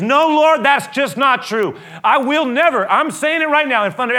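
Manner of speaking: high, loud protest. Acted. Peter protesting loudly to Jesus. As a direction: refuse to accept it.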